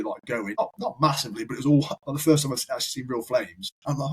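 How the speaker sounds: normal talking voice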